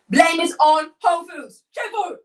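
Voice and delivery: loud, high mock indignation; indignant exclamation. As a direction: declare it loudly, blaming the store. Mock outrage at Whole Foods.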